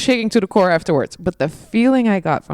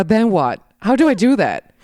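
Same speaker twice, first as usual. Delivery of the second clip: raised, demanding plea; exasperated retort; 5 semitones higher, 5 dB louder than usual. Acted. Listener answering empty comfort-zone advice, exasperated. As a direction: push back loudly, wanting real answers. Voices frustrated reaction to advice.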